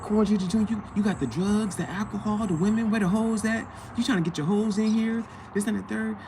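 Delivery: high mock taunt of the girlfriend. Girlfriend taunting him as [name], high mock voice. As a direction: sneer at him like she did. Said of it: "she called him [name] Junior".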